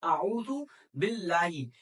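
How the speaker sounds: slow Quranic recitation of आऊज़ु बिल्लाही; आऊज़ु बिल्लाही drawn out in tajweed style. Shown as a demonstration. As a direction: recite आऊज़ु बिल्लाही slowly, throat sounds clear. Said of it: "नीचे उनको colours में किया हुआ है".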